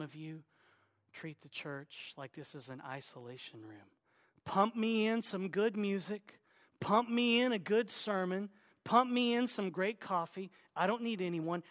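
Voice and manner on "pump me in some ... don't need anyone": higher, louder mock demands; insistent, repetitive demands in a put-on tone; mock demanding churchgoer. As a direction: imitate a self-sufficient churchgoer placing orders. Mimics self-sufficient churchgoers' demands.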